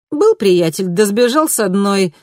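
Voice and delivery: high, loud, brash vixen. A narrator's reading. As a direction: voice the sassy city vixen. Fox voiced high and loud.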